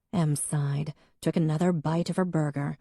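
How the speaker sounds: usual speaking voice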